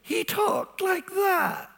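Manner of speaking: very high, slow, thin imitation; high-pitched voice of an elderly scholar; Dallas Willard's high voice. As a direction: mimic a frail old man's high-pitched voice. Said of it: "Dallas had a very high-pitched voice".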